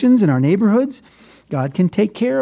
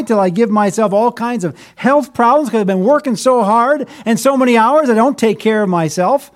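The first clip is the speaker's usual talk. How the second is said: ordinary delivery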